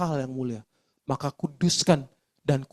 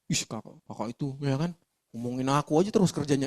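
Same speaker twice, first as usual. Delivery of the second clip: lower, softer sulky whine; sulky retort; 4 semitones lower, 6 dB quieter than usual. Retold or imitated. A junior pouting at the senior who asked, sulky and soft. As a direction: pout and complain at the nagging senior. Junior snaps back sulkily.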